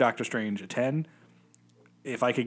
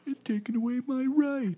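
whiny mocking voice; 14 semitones higher than usual. Mocking anti-maskers.

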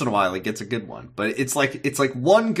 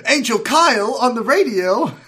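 mock announcement; 10 semitones higher, 5 dB louder than usual. Naming new silly angel.

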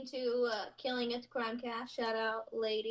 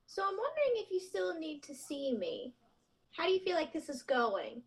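higher, careful therapist voice; put-on higher counselling voice; imitated therapist; 4 semitones higher than usual. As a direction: lightly mimic a therapist broaching an awkward question. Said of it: "and she was like".

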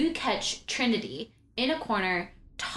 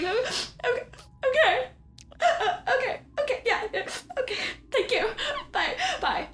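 much higher, laughing-crying resigned okays; tearful high okays. Acted. Trinity dismissed, high tearful laugh-cry farewell. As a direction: swallow tears, feign composure.

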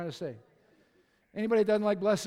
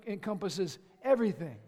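usual speaking voice

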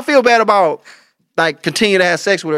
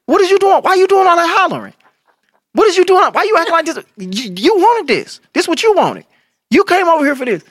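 high-pitched comic protest; mock bedroom scolding; 12 semitones higher than usual. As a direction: act out an indignant lover, voice pushed way up. Same bedroom act, scolding partner.

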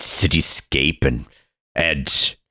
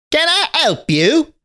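put-on much higher, small well-dressed boy; little boy voice; slower than usual. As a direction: pipe up like a tiny butler boy. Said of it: "And he says".

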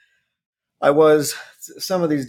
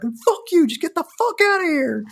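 replayed furious yell, far higher; angry yell. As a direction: tell him off at full pitch. Yelling at dude talking shit.

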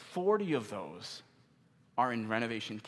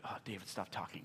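muttered objection; 5 semitones lower, 11 dB quieter than usual. Retold or imitated. Voices a listener's reluctant thought.

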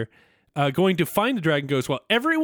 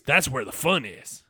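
normal talking voice

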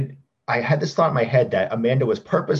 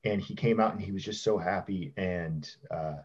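teary, softer voice near breaking; holding back tears quietly; after crying; 2 semitones lower, 7 dB quieter than usual. Real, not acted. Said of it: "it's gonna make me teary".

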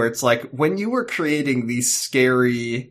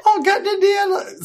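put-on British accent; 3 dB louder than usual. Host imitating a boy refusing the stairs, high mock British accent. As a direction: whine it in an exaggerated British accent. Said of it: "worse than the British accent".

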